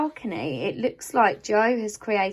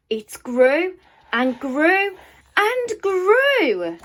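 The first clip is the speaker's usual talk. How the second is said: higher, louder and slower with each grew; growing wonder; 9 semitones higher, 4 dB louder, much slower than usual. A narrator's reading. Narrator marvels at the seed growing, building up higher and louder. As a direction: stretch it out as the seed shoots up.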